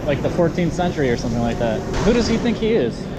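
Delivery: ordinary talk, nothing marked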